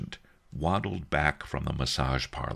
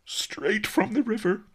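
high, tearful half-whisper; weepy high old-master voice; sobbing; 4 dB louder than usual. A narrator's reading. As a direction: sob the answer mournfully. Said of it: "sobbed Master Li".

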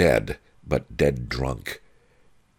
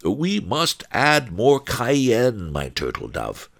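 starts high and drawn out, then drops; lightly voiced wine seller; 9 semitones higher, slower than usual. A narrator's reading. One-Eyed Wong telling his wife the recipe, lightly doting. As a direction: lightly play a doting husband.

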